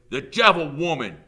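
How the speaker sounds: loud, high cry of denunciation; accusing shout. A narrator's reading. Mob leader denouncing the teacher, shouting. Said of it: "Trout shouted".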